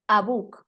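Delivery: "a book" linked into one quick unit; todo corrido. Shown as a demonstration. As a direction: run "a book" together without a break. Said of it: "todo junto y se oye todo corrido". ("a book" todo corrido, sin pausa)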